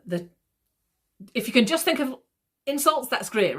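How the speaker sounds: usual speaking voice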